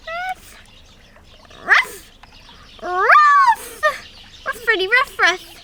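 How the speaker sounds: high mock puppy barks; playful high ruffs. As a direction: bark like a little toy dog. Toy dogs bark their ages.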